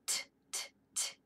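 short unvoiced T clicks; crisp T taps. Shown as a demonstration. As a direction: tap out "T" like a tooth. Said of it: "letter sound drill".

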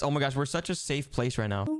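ordinary delivery

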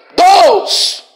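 high, explosive cry; shouted invocation. Real, not acted. Preacher calling on God to bind the spirit, shouting. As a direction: belt it out high and long. Crying out during deliverance.

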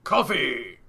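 "coffee" said loud as the anime name, for Koffing; "coffee" in a loud throwaway; old mispronunciation. Retold or imitated. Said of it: "as we thought it was pronounced way back in the day".

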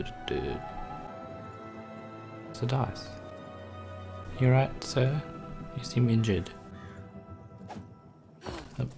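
normal talking voice

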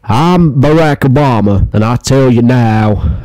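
bad presidential impression, slow and starting high; hammed-up, drawn-out American statesman voice; Barack Obama. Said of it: "he doesn't sound like that".

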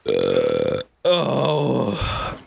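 pained cry. Real, not acted. Pepper pain, crying out.

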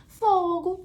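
loud, high drawn-out exclamation фогу; exclaimed curse. Shown as a demonstration. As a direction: cry out фогу like an exasperated expletive. Acts Portuguese exclamation.

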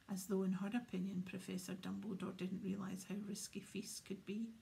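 ordinary delivery

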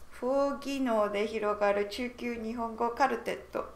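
normal talking voice

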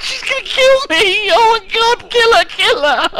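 high frantic panic; panicked shriek. Real, not acted. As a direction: squeal it out in terror. Panicking as enemy attacks.

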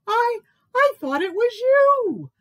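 very high, loud, stammering mouse; jumpy high mouse voice; nervous mouse squeak. A narrator's reading. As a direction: squeak it out in a fluster. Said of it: "squeaked Mouse nervously".

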